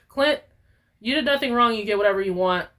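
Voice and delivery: ordinary talk, nothing marked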